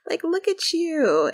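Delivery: put-on high, gushing surprise at an old friend; excited gushing greeting. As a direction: squeal it like spotting an old roommate. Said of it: "She's like".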